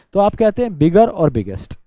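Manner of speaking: normal talking voice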